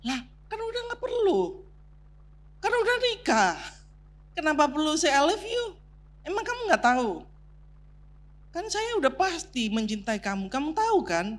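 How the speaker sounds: high, mock-whiny husband voice; husband's dismissive reply. Husband shrugging off his wife's complaint, high mock whine. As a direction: play the puzzled husband brushing it off. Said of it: "Suaminya mengatakan apa?".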